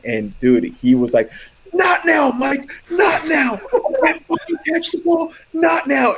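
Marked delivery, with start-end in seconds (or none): 1.7-6.1 frustrated shout. Retold or imitated. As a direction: yell it, fed up and losing it. Josh snapping at Mike, retold.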